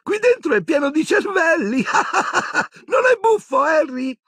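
very high-pitched giddy voice breaking into laughter; giggling. A narrator's reading. Dazed Ron shows Harry the brains, giggling. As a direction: marvel and crack up like someone dazed.

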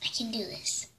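hushed whisper to herself. Acted. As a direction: whisper it under your breath. Concentrating alone on the experiment.

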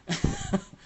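sudden high laugh bursting out; quick high real laugh; burst of laughter. Real, not acted. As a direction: laugh at your own dark joke. Laughs after grim joke.

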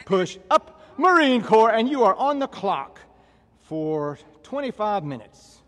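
loud, high drill-instructor call; exercise command. Real, not acted. Trainer starting the class on the clock, loud command.

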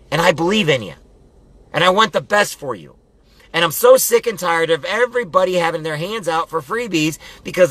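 high, forceful pep talk with punched commands; fired-up motivational preaching. Real, not acted.

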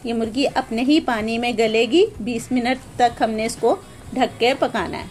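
ordinary talk, nothing marked

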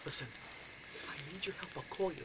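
whisper. Retold or imitated. Telling the roommate the plan in a whisper. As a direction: whisper the plan so nobody hears. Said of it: "I whisper".

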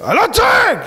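hammed-up shouting denial; mock furious yell. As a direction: yell that you're not yelling. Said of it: "הוא צועק כי הוא לא שולט".

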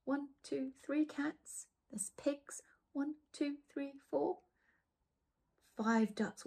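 usual speaking voice

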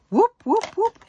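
high, loud happy whooping; excited whoop. Real, not acted. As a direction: cheer like you won. Cheering at upgraded tweezers.

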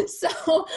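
high-pitched burst of real laughter; laughing at herself over zero training, high and loud. Real, not acted.

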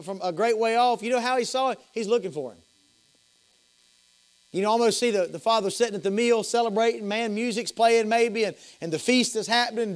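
high, loud revival preaching build; fired-up preacher painting a scene; fervent preaching. Acted. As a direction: paint the feast scene at full preaching force. Revival storytelling at full voice.

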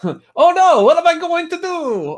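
high, loud, hammed-up Italian Mario voice; terrible Italian accent. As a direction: squeal it like a cartoon Italian plumber in a panic. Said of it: "like, a terrible Italian accent".

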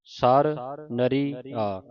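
ਸਰ ਨਰੀ ਆ drawn out slowly; ਸਰ ਨਰੀ ਆ spelled out slowly; slow, careful ਸਰ ਨਰੀ ਆ; slow demo. Shown as a demonstration. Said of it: "shows the reading".

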